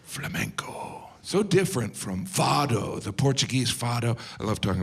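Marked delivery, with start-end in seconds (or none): 0.0-1.1 slow, hushed whisper; whispered name. Real, not acted. Comic whispering the word flamenco. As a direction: breathe the word out. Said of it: "whispered name".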